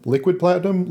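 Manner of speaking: liquid platinum lifted high with relish; a playful high-pitched "liquid platinum"; emphatic repeat. Acted. Savoring the foreman's odd demand.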